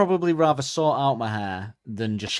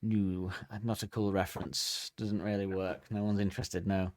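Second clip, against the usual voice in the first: softer, unimpressed murmur; deflated crowd; 3 semitones lower, 9 dB quieter, slower than usual. Retold or imitated. Classmates unimpressed by the lame joke, softer and flat. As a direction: let the groan fizzle out. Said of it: "Everyone go".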